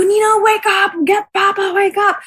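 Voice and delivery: high, urgent half-laughing plea; panicked plea. Retold or imitated. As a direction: beg her up, pitched high. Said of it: "And we go".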